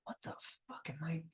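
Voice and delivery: hushed, voiceless aside to himself; stunned whisper. Retold or imitated. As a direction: whisper it in private disbelief. Awed guest mutters to himself at celebrity table.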